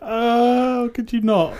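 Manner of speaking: high, pleading groan; whiny, mock-suffering plea; pained protest. Real, not acted. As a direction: beg them to stop, pitched high. Protests at bad French accent.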